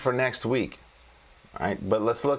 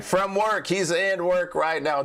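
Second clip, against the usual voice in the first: amused remark; 7 semitones higher than usual. Real, not acted. Amused at viewer at work.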